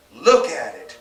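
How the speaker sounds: loud sharp command; loud order shoved at her husband. A narrator's reading. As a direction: bark it at him, loud. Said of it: "she ordered".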